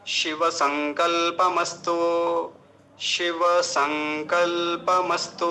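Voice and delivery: slow, drawn-out शिव संकल्पमस्तु as one phrase; joined-up recitation. Shown as a demonstration. Teacher joining three words for students, slow recitation. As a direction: link शिव संकल्पमस्तु, stretching kal and the last vowel. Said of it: "पूरे को एक साथ मिला के".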